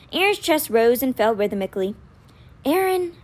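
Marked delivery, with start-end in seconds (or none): none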